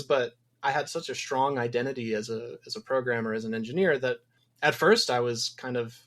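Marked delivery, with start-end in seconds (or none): none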